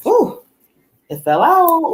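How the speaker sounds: high, sudden surprised yelp as the card drops; startled by the falling card; surprised ooh. Real, not acted. Reader reacting to a card falling out, a high surprised cry. Card falls out mid-reading.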